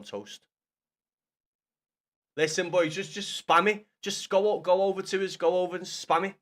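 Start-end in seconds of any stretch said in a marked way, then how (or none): 2.4-6.3 louder, urging the viewers on; loud rallying of the chat; rallying call to chat. Real, not acted.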